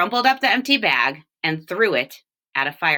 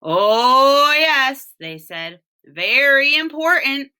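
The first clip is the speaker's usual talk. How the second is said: woodcutters' voice; 7 semitones higher, 6 dB louder, slower than usual. Acted. Woodcutters answer, loud and high.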